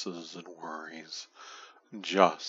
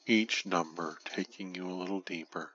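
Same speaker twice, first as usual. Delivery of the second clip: normal talking voice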